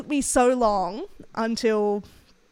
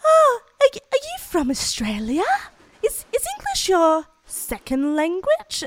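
higher, louder mock client voice; imitating clients; 9 semitones higher, 6 dB louder than usual. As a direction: play the older client asking, pitched up. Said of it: "been like".

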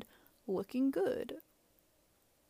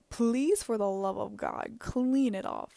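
loud, punchy exasperated begging; emphatic plea; 7 dB louder, slower than usual. Real, not acted. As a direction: plead with them, hitting words hard. Begging listeners to clean up.

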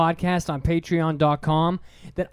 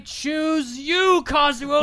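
playful shout; 8 semitones higher, much slower than usual. Acted. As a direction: call out to him gleefully. Chasing him with cash.

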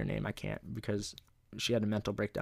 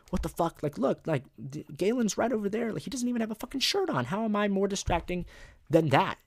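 much higher, fast mock-outraged girl; pitchy parody of an annoyed classmate; indignant girl; 10 semitones higher than usual. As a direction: squeal her complaint in a high whiny protest. Girl complains while being dress coded.

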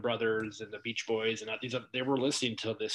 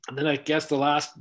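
ordinary talk, nothing marked

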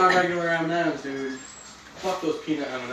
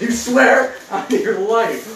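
mock threat; 6 semitones higher, 9 dB louder, much slower than usual. Step Brothers reenactment, slow louder.

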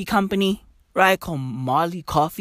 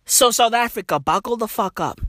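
ordinary talk, nothing marked

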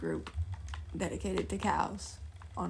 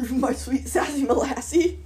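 laughing echo; 3 semitones higher, 7 dB louder than usual. Retold or imitated. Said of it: "friend repeats the phrase".

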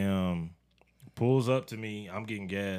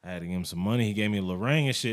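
ordinary talk

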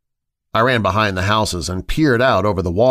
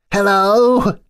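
high, louder teasing repeat of hello; mocking echo; 12 semitones higher, 4 dB louder than usual. Josh mocking the call, teasing high echo. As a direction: throw the word back as a joke.

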